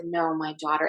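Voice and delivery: very soft, gentle refusal; hushed, gentle reassurance. Retold or imitated. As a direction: say it softly and tenderly, quietly holding them back. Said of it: "in the most gentle voice".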